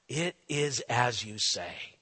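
soft, low, slow answer; hushed resigned reply; quiet calm reply. Acted. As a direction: answer softly and slowly, resigned.

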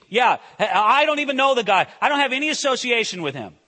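loud, fast brush-off; dismissive Peter. Retold or imitated. Preacher voicing Peter disowning Jesus, loud and fast. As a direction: wave him away loudly and quickly.

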